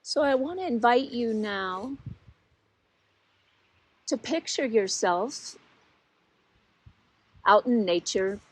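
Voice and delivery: unhurried guiding voice with long pauses; guided meditation. Real, not acted. Teacher settles listeners into body, mind and heart, unhurried guided meditation. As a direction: lead them gently inward, leaving long silences between phrases.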